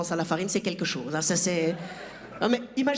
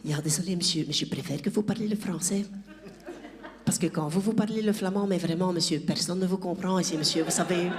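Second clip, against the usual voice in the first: lower-voiced Flemish schoolteacher speaking French; teacher impression voice; 3 semitones lower than usual. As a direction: apologetically put him straight as the teacher. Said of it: "même la juf m'a dit".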